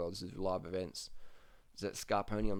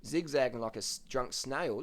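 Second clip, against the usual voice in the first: ordinary talk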